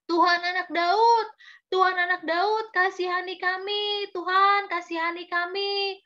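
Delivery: high, sustained pleading call; acted storybook cry, high but not full-throated; insistent crying out. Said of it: "tetap semangat terus berteriak-teriak".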